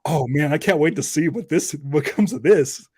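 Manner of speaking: ordinary talk, nothing marked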